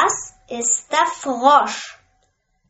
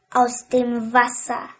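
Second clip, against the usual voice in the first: plain reading voice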